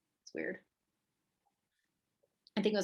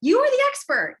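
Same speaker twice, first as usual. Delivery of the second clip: bold and much higher, louder; confident; 13 semitones higher, 7 dB louder than usual. Shown as a demonstration. Coach to clients, confident emphatic delivery. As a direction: assert it like the expert. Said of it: "ask with confidence".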